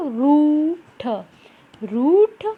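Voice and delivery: रूठ with a loud, stretched "oo"; teacher's lengthened, emphatic रूठ; long drawn-out vowel. Shown as a demonstration. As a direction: pull the vowel in रूठ long before the final sound. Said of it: "तो कैसे पढ़ेंगे हम इसको".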